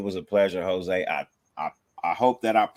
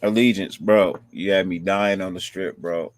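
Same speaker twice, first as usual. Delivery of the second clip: ordinary talk, nothing marked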